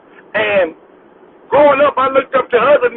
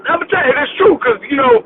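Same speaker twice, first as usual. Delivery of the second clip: usual speaking voice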